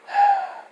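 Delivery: audible sigh before answering; acted sigh. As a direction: let out a thoughtful sigh. Character sighs before answering.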